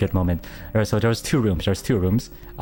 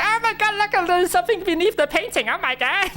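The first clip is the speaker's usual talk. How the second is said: very high, squealing excitement; hammed-up eureka in a squeaky voice; mock amazed discovery. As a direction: retell the big find as an overblown squeal. Retold excited discovery of the clue.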